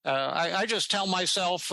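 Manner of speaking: high, bright-edged New York twang; a light imitation of an old New Yorker's voice; an imitation of Albert Ellis's New York accent. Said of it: "this is the way he talked".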